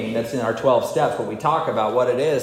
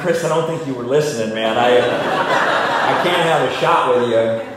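laughing refusal; 6 dB louder than usual. Retold or imitated. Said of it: "I'm like".

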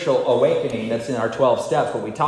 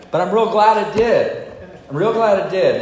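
grateful; 8 semitones higher, 6 dB louder than usual. Real, not acted. Grateful repetition.

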